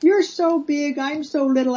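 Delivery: put-on high child's voice, gradually sliding down; high-pitched, plaintive little-kid impression; little girl. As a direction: imitate a small girl addressing a grown-up. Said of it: "this little girl says".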